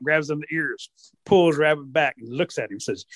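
ordinary delivery

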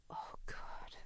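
soft unvoiced sigh of embarrassment; a mortified whisper to herself; whispered oh God. Real, not acted. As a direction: breathe it out, mortified. Inner embarrassed groan.

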